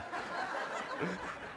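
quiet airy chuckle; soft laugh. Real, not acted. Preacher chuckling at his pregnancy drugs joke. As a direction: chuckle under your breath.

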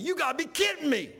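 high, loud, incredulous yell; acted-out outraged phone yell. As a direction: re-enact yelling into the phone in disbelief. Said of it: "I'm yelling in the phone".

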